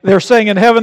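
ordinary delivery

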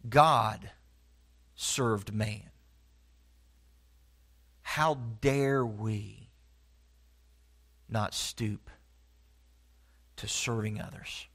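low, soft and slow, with long pauses between phrases; quiet, intense altar-call close; hushed conviction. Real, not acted. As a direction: drop to near a murmur and let each phrase sink in. Sermon's hushed closing appeal.

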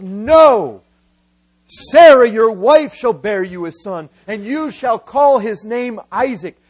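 loud, forceful refusal and proclamation; booming decree. Acted. God answering Abraham's plea, loud commanding decree. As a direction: thunder the no, then declare it firmly.

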